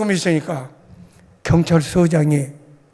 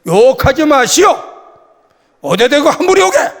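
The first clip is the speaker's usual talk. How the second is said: louder, higher scolding; retold stern shout; 6 semitones higher, 3 dB louder than usual. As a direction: reenact telling him off. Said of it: "그런 소리 내니까".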